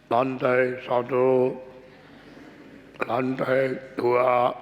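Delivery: slow, low elevator announcer; robotic lift voice. Shown as a demonstration. Preacher imitates the lift's computer, slow and low. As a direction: announce floors like a machine. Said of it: "Mesti bicara".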